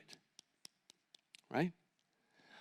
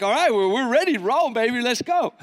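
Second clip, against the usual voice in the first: cocky swagger; 4 semitones higher, 5 dB louder than usual. Retold or imitated. Cocky singer ready to perform.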